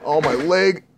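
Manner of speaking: ordinary delivery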